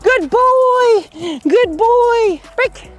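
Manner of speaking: high, bright, loud dog praise; squeaky praising a dog. Real, not acted. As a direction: praise the puppy in a squeaky happy voice. Trainer calling and praising dog.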